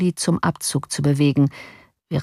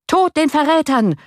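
high, louder furious crowd cry; furious shout; 10 semitones higher, 4 dB louder than usual. A narrator's reading. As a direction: hurl it like an enraged mob. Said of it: "schrie die Kompanie der Bürger außer sich".

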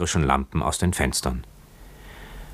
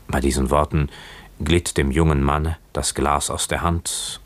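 ordinary delivery, nothing marked

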